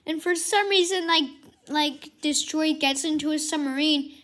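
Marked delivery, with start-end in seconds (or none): none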